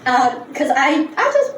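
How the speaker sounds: ordinary talk, nothing marked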